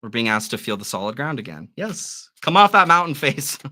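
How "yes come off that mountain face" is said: loud urging. Real, not acted. Urging Capricorn down.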